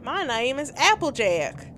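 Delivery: slow, drawn-out Southern twang; Applejack. Acted. Player testing her recovered accent, slow Southern Applejack twang. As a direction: introduce yourself in a put-on Southern drawl. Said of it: "I got it back".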